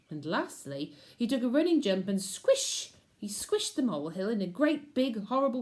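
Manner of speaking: plain reading voice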